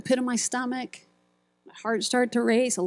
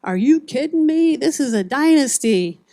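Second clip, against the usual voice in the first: higher, louder mock announcer boast; hyped announcer; 5 semitones higher, 5 dB louder than usual. Speaker imitating the announcer bragging, higher and louder. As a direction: crow it like a cocky PA announcer. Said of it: "and then he goes".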